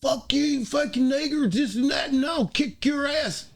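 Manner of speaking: high, loud mock accent, shouting; hammed-up foreign bully threatening; mock Swiss yelling. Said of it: "the way he was talking to me".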